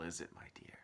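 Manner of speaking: hushed secret whisper; whispered promise. A narrator's reading. Old man whispering the magic promise to James.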